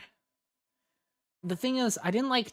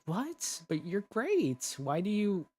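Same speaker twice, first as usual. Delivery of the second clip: lightly voiced yandere, higher pitch; a hint of a higher-pitched, disbelieving yandere; 7 semitones higher, slower than usual. Yandere reacting to an insecure listener, lightly acted, higher-pitched. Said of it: "the yandere is like".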